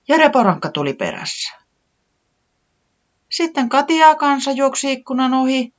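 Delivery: high, eager small chick; little Tiputi voice. A narrator's reading. Tiputi recounting what she saw, high and eager.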